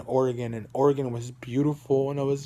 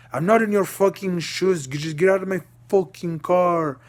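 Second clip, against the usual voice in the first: higher, far-off-from-own-voice angry driver; retold angry yelling; 4 semitones higher, 4 dB louder than usual. Uber driver orders a passenger out of his car, angry yelling. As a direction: imitate an irate driver yelling. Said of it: "him just screaming at me".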